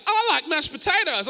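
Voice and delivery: high, loud daft voice; dopey stranger. Retold or imitated. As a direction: play a clueless oddball.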